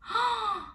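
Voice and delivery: sharp high gasp of delight. Real, not acted. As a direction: gasp in happy surprise. Delighted gasp at product shade.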